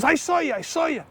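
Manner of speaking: high-pitched joke witness calling it out; excited accusation. Acted. Joke witness pointing at a bank robber, excited accusation. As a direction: blurt it high, as an excited eyewitness.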